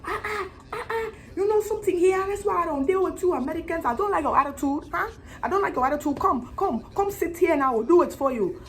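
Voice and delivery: put-on high West African accent braider scolding a customer; put-upon braider in a high West African accent, shooing off the Americans. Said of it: "excuse me, accent".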